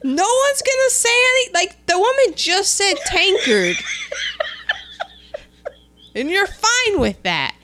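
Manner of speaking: high-pitched, scandalized protest; indignant audience. Retold or imitated. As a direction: imitate outraged listeners demanding action. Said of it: "picture the audience going".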